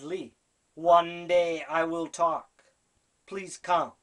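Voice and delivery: slow, loud, spelled-out repeat. Retold or imitated.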